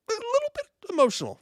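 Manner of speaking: high squeaky mock sob; mock emotional squeak.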